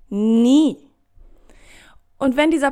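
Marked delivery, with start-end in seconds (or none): 0.1-0.8 louder, stronger Nie; loud emphatic never. Real, not acted.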